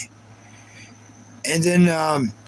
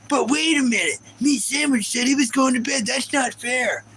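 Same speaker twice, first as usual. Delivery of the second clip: high mocking whine, a bit higher; mock whining; 6 semitones higher than usual. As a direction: whine like a sore loser troll, higher. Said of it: "he started whining about it".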